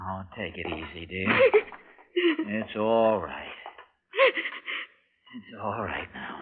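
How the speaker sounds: tender comfort. Acted. Comforting crying wife.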